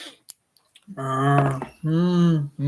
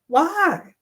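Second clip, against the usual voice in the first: higher female character voice; Alice Levin voice; 11 semitones higher than usual. Acted. Alice Levin to Doctor Stone, higher female voice. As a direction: play a chatty mother, pitched up. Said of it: "Levin said".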